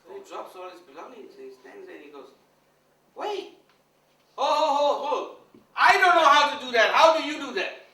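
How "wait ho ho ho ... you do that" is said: very high, loud desperate call; panicked shout. Retold or imitated. Guru shouting after the milkmaid, high and loud. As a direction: yell after her as she walks off.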